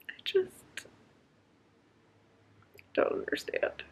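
whispered, drained of disbelief; defeated whisper. Real, not acted. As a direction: breathe it out like you give up. Exasperated rant about a book scene.

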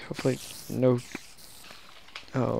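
ordinary talk, nothing marked

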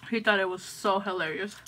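talking around carrot slice; nibbling while speaking; biting into food. Real, not acted. Seen 2: carrot slice held between lips, nibbling while speaking.

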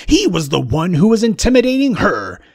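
ordinary talk

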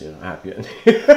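ordinary talk, nothing marked

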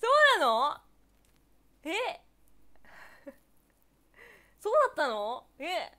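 exclamation de surprise, très forte. Real, not acted. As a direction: react loudly, caught off guard by news. Surprised by a viewer's comment.

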